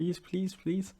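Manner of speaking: high mock child's pleading; high-pitched imitation of begging; begging kid. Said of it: "like those kids on Pet Simulator".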